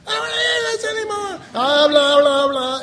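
high, fast, loud petulant whine; mock whiny refusal. Preacher mimicking listeners who won't listen, high fast whine. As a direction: sulk like a bored child.

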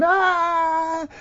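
high, held mock baby wail; newborn's hungry cry. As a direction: imitate an infant crying nonstop. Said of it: "just like a newborn baby desires the milk by going".

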